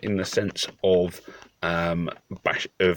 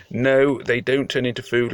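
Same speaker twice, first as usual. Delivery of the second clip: normal talking voice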